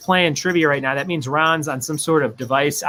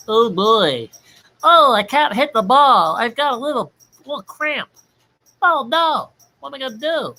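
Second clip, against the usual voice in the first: high, pained whiny ballplayer; a much higher strained voice, groaning about a cramp; 8 semitones higher, 3 dB louder than usual. Pretend. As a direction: squeak it out like a cramped-up slugger. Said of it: "baseball player cramping up voice".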